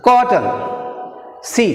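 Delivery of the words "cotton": Cotton with high COT, falling end; first-syllable stress. Shown as a demonstration. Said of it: "Stress is shown".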